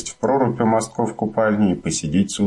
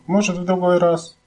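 sleepy mumble; 12 semitones higher than usual. A narrator's reading. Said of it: "пробормотала во сне мама".

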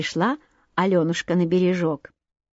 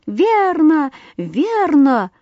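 high, eager cheer of agreement; lightly shouted chorus of assent; crowd calling out; 7 semitones higher, 4 dB louder, much slower than usual. A narrator's reading. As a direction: cry out as a bird crowd. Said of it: "хором крикнули опять все".

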